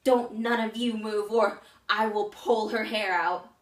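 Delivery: loud, slow bully's threat; menacing and forceful, at full volume; Max. Acted. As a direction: shout the threat, each word drawn out. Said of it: "I heard Max say".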